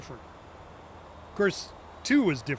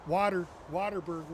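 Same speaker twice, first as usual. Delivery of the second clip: ordinary delivery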